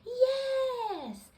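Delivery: high, loud cheer; excited yes. Acted. Peppa thrilled at a spooky show, high cheer. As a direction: cheer with delight. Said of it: "cried Peppa".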